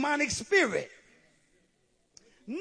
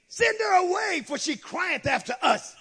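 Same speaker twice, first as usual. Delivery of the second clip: high, raised-voice demand; calling out; 9 semitones higher, 7 dB louder than usual. Retold or imitated. Disciples urging Jesus, calling out. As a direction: read it out as a forceful demand.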